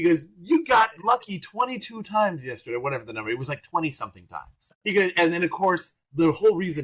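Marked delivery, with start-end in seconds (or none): 0.4-2.8 higher teasing mock voice; loud crazy Gary. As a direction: tease him in front of the room. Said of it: "to be loud and crazy and funny".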